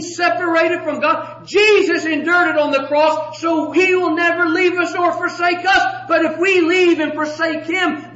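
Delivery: loud, high-pitched hellfire sermon; urgent pulpit warning with stretched, punched key words; fervent preaching. Acted. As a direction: preach it at full force, driving each clause home. Preaches at full force.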